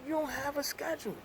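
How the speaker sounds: high, incredulous quote; mock disbelief to the boss. First mention, quoted to boss.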